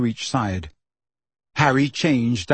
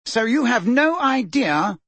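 high, slow, drawn-out sarcasm; mock-incredulous and drawling; heavy sarcasm; 10 semitones higher, slower than usual. A narrator's reading. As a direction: lay the sarcasm on thick. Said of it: "a voice positively sagging with sarcasm".

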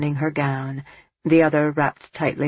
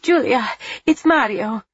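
cracking with relief; 7 semitones higher than usual. A narrator's reading. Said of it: "her voice cracking with relief".

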